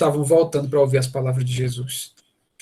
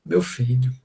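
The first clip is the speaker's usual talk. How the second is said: tender father; 4 semitones lower, slower than usual. Retold or imitated. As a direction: say "Meu filho" like a loving father. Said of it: "como é que o pai fala?". (pai falando com ternura)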